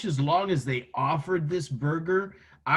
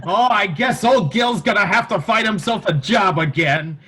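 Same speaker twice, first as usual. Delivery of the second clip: louder, higher sad-sack Gil voice; Gil from The Simpsons; 5 semitones higher, 8 dB louder than usual. Retold or imitated. Friends joking about the fired executive, as Gil. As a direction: do a hapless, hopeful Gil. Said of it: "He was like".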